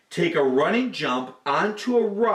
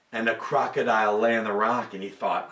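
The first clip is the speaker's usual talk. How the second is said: usual speaking voice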